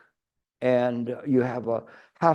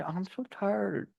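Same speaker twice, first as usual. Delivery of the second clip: higher, much softer and slow, dragging "so"; whiny tired moan; 6 semitones higher, 10 dB quieter than usual. Retold or imitated. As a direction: stretch it out like a drained complainer. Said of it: "you hear people and they say".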